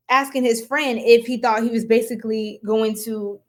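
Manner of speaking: ordinary talk, nothing marked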